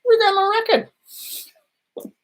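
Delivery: very high, slow, mocking official voice; hammed-up agency answer; IRS reply. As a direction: drawl it out like a smug bureaucrat. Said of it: "what the I R S said".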